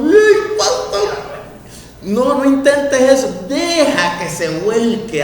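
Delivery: high squealing mock fright; mock alarmed congregant. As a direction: play a frightened churchgoer. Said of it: "Y todo". (una hermana asustada, chillido fingido)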